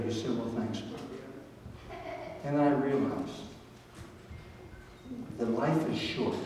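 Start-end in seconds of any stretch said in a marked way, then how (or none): 0.4-1.0 put-on soft, weary thanks from a hurt old man; a hint of an injured dad, softer; pained old father. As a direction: say it quietly through the pain. Injured old father answering his son.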